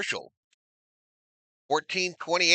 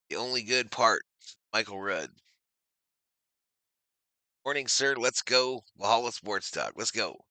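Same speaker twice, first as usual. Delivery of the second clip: ordinary talk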